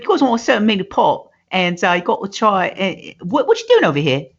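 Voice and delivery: put-on high, nagging English accent; mock English lady. English lady questioning fiber workers, high mock English accent. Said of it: "she's English".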